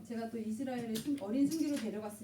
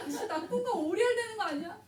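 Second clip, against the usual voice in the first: laughing complaint; 9 semitones higher, 4 dB louder than usual. Retold or imitated. Mock complaint while laughing.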